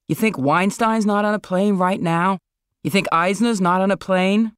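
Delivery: mild incredulous jab at a friend; scoffing friend. Acted. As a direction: toss off a cynical challenge, half amused. Marlon's skeptical retort.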